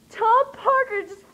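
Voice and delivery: loud, high, drawn-out announcement; re-enacted fan excitement. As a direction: break huge news in a fangirl cry. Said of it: "I was so excited".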